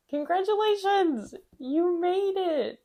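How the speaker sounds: high, loud, drawn-out cheer; excited congratulations. Pretend. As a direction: celebrate their win in big, ringing, stretched words. Cheering viewers for saying yes.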